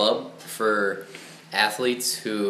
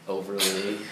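ordinary delivery

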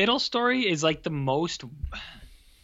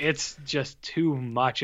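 slow overwhelmed; much slower than usual. Retold or imitated. Recalling sensory overload.

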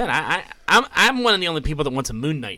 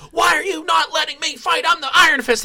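whining brat; 11 semitones higher than usual. Retold or imitated. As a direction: whine like a spoiled kid denied a fight. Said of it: "like whining".

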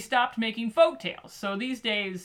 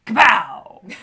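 loud slow kapow; 12 dB louder than usual. Pretend. Imagining slapping a mosquito.

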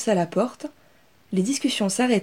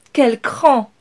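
higher, louder admiring cry; admiring approval; 5 semitones higher, 8 dB louder than usual. Acted. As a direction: cheer her nerve. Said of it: "approuva sa cousine".

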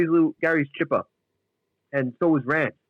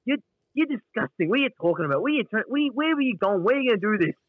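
high disgusted Gary; 8 semitones higher than usual. Retold or imitated. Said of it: "Gary's like".

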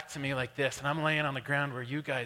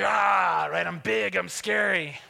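loud, high roar easing slightly; re-enacted scare-off shout; 4 semitones higher, 8 dB louder, slower than usual. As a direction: bellow to look big and scary. Re-enacts yelling to scare the bear.

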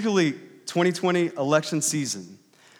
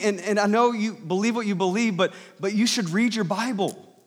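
raised, higher voice easing down a little; calling out; 4 semitones higher than usual. Retold or imitated. Pastor urging visitors in his driveway, calling out. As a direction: keep projecting to someone down the drive. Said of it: "I was talking like this".

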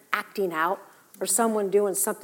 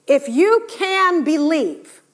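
slow, loud proclamation, higher at first; emphatic declaration; 4 semitones higher, 5 dB louder, slower than usual. Acted. Preacher voicing Jesus answering the father, slow and loud. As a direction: proclaim it slowly with full force.

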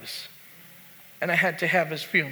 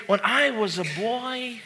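put-on Brooklyn accent; 4 semitones higher, 3 dB quieter than usual. Pastor quoting his dad, Brooklyn accent. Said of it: "he'd say it in his Brooklyn accent".

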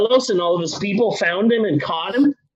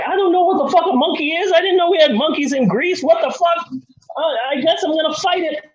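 high, fast, bewildered cartoon dog; squeaky panicked pet who's never seen a monkey; confused dog voice; 9 semitones higher, faster than usual.

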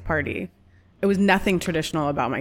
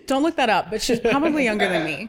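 laughing; 4 semitones higher than usual. Real, not acted. Joking about age, laughing.

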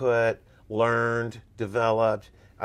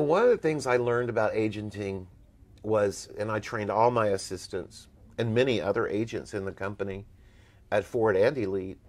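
ordinary delivery

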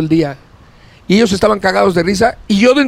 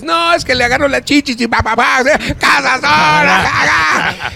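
imitating a cocky fan; 9 semitones higher than usual. Fan bragging to the comedians after a show, hammed-up excited shout. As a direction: ham up a show-off fan laughing at his own story. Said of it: "Tú sales y dices".

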